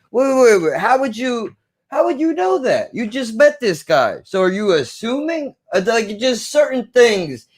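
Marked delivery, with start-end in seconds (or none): 0.1-4.2 sudden high-pitched double take; surprised challenge. Retold or imitated. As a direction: catch him out, pitched well up. Voicing a character catching a slip.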